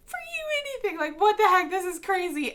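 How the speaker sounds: very high, crying squeak; tearful squeak. Retold or imitated. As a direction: squeak it out close to tears. Overwhelmed fan answering Taylor.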